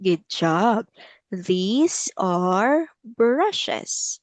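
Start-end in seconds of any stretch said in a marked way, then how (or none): 1.3-4.2 very slow, each word stretched out; teacher's slow repeat-after-me delivery; slow model sentence. Shown as a demonstration. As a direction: draw out the words for a learner. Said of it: "Let's say it slowly".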